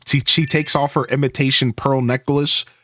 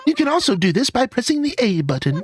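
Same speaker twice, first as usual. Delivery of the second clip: high put-on villager lady voice; chatty high-pitched lady giving helpful advice; Barbara; 13 semitones higher than usual. Villager explaining fruit trees to the player, high lady voice.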